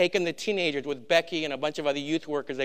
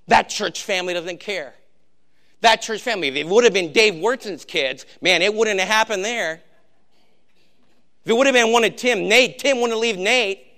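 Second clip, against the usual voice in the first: louder, higher indignant outburst; retold parents' anger; 4 semitones higher, 6 dB louder than usual. Pastor voicing angry parents to the congregation, indignant raised voice. Parents furious over lost kids.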